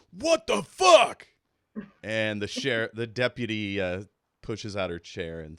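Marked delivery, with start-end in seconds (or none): 0.1-1.3 high, loud, slow outburst; shocked shout. Acted. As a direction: yell it in disbelief. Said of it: "and he goes".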